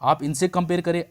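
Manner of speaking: ordinary talk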